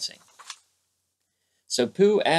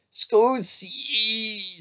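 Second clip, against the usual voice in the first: muffled excuse; 10 semitones higher than usual. A narrator's reading. Tigger excuses himself, mouth full.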